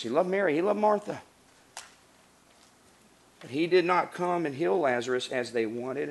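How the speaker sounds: usual speaking voice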